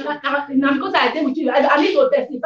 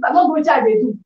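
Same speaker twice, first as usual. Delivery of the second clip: ordinary talk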